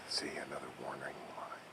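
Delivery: hushed spooky whisper. Acted. Parent whispering a warning about the chalk path. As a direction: breathe it like a secret warning.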